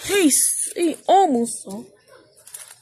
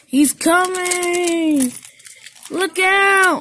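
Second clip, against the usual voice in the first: warning shout; 6 semitones higher, 4 dB louder, much slower than usual. A narrator's reading. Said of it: "also said Percy".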